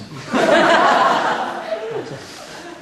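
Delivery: high laugh bursting out after the punchline; burst of laughter. Real, not acted.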